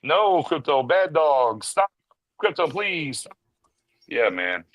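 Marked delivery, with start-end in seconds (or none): 0.0-3.3 lightly higher half-hearted scolding of a dog; mock Superman scolding. Reviewer mocking Superman scolding Krypto, with half-hearted pleading. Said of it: "and Superman going".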